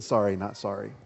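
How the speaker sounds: a quiet, dismissive sorry not sorry. Retold or imitated. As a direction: shrug the words away quietly. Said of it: "I might be saying this".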